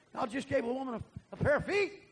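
high, excited outburst. Retold or imitated. Boy telling granddaddy of the miracle, high and excited. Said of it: "I got so excited".

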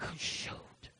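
barely audible, voiceless mutter; disappointed aside. Retold or imitated. Dinner guest hearing the menu has changed, muttering in disappointment. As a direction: let the letdown slip out under your breath. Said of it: "You're like".

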